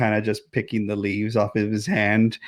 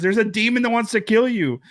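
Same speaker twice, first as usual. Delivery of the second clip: ordinary talk, nothing marked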